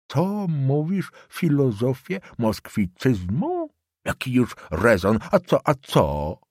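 put-on slow, sneering old man; old councillor mocking the student, slow and sneering. Old councillor mocks Borowicz.

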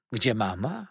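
unsure, stalling echo with a rising end; buy time before the bad news; Peggotty hesitantly echoing the boy's question; hesitant repeat. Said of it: "wahając się".

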